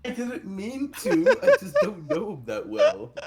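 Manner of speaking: high, giggling through the words; laughing. Real, not acted. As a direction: laugh out the excuse. Player laughs defending himself.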